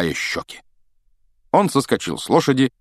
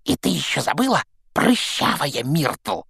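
sly hissing poltergeist; 7 semitones higher than usual. Acted. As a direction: hiss the insult in her ear. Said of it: "прошипел ей в ухо Пивз".

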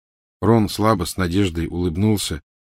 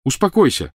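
shout; 7 semitones higher, 3 dB louder than usual. Acted. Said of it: "крикнул Гарри".

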